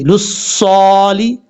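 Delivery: लुस्सौली drawn out slowly, full heavy saad; slow recitation. Shown as a demonstration. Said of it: "पुर पढ़ा जाएगा".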